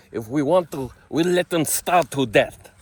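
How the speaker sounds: high, loud, dragged-out mock Israeli accent; exaggerated Israeli accent. Redoing the fake Knesset quote, hammed-up Israeli accent. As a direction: ham up an Israeli accent, booming and slow. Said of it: "that was a bad accent".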